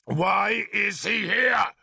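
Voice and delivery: slow, high angry shout; angry demand. A narrator's reading. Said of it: "more angrily".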